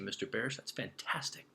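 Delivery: ordinary delivery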